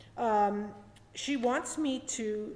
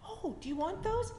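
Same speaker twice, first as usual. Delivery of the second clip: higher, lightly voiced sweet old lady; kind old lady offering; 10 semitones higher than usual. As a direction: offer it kindly like a gentle old woman. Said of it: "she had said".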